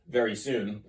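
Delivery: ordinary talk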